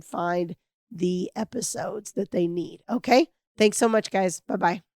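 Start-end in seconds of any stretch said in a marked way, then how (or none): none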